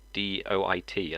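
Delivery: slow, letter-by-letter spelling; spelled out. Shown as a demonstration. Spelling the mistake on his chest, slowly. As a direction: separate each letter of "I D O I T".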